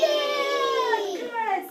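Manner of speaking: high loud cheer; delighted praise. Real, not acted. Praising the kids' answer, delighted cheer. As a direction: reward the right answer.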